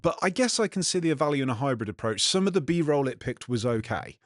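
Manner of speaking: ordinary talk, nothing marked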